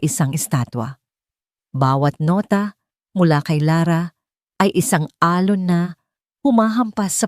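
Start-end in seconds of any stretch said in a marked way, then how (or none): none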